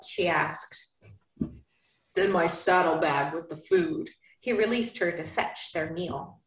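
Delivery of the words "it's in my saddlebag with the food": low, slow Southern man's voice; hint of a Southern cowboy; Southern accent. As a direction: drop low and drawl it Southern. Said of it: "I don't know why I'm going Southern with her".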